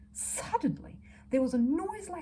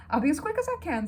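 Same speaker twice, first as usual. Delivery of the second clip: higher, louder motherly promise; reassuring mother; 7 semitones higher, 7 dB louder than usual. Acted. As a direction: reassure the sick bear brightly. Mrs Brown hurries off for Judy.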